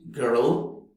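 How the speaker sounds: put-on girl with a strong American r. Shown as a demonstration. Teacher showing rhotic American girl, American accent. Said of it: "in American English".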